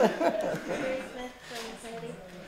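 real amused chuckle, fading; trailing laugh. Real, not acted. Laughing after joking with students.

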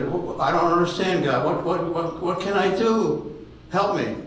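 high, pleading voice to God; confused pleading. Retold or imitated. Old man begging God for help, high and confused.

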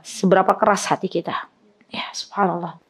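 hushed, solemn whisper. Real, not acted. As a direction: drop to a whisper of awe. Solemn reflection on hard heart. (berbisik khidmat)

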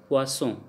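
"poisson" with double s as a hissed s; s sound. Shown as a demonstration. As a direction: keep the ss in "poisson" voiceless, as s. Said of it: "then we pronounce like se".

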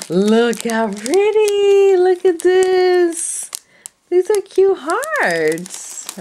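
high, loud, slow cooing; drawn-out awed coo; gushing. Real, not acted. Crafter showing viewers cute hearts, slow high coo.

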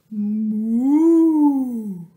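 loud booming moo; cow moo. Acted. Cow arrives in story.

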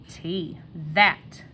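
ordinary talk

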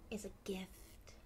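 hushed tender whisper. Acted. Mother whispering the meaning to her daughter. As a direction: breathe it like a secret.

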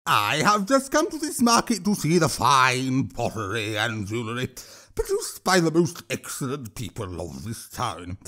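a lightly voiced troll, smug and mocking. A narrator's reading. Troll mocks the grey-haired linen seller.

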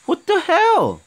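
high, loud indignant disbelief; can't believe what happened; stunned protest. Real, not acted. Gamer protesting a sudden death, stunned.

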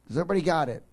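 ordinary talk

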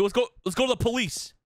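ordinary delivery

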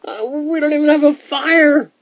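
put-on very high, loud boy's protest; loud, high-pitched indignant child; protesting cry. A narrator's reading. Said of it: "cried Herschel".

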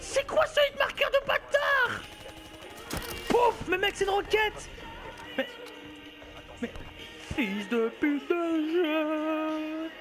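high exasperated rant; raging gamer. Real, not acted. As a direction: rage at the game. Ranting at hit marker and rocket.

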